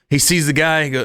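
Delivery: ordinary talk, nothing marked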